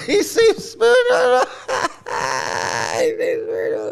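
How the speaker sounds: real high-pitched cackling through the words; incredulous words dissolving into laughter; laughing. Real, not acted. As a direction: burst out laughing as you say it, voice giving out. Mocking the guru, cracking up.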